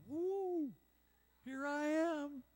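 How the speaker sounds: high, loud, stretched Woo then slow here I am; joyful whoop. Retold or imitated. Believer answering God's call, high joyful whoop. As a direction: burst out high with joy. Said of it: "And you go".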